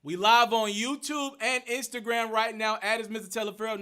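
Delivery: loud, projected host voice; livestream hype announcement. Real, not acted. Host announcing the YouTube and Instagram live to viewers, loud hype. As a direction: project it loudly to the whole audience.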